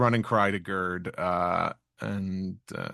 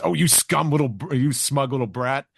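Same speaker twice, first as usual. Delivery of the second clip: mock indignant, higher and louder; 6 semitones higher, 5 dB louder than usual. Sanji insulting the smug child, mock indignant. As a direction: sneer at the brat as Sanji. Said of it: "And Sanji says".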